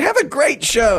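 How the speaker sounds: high, sing-out chipper imitation; mock a relentlessly cheery colleague. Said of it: "He was all chipper. He was all like".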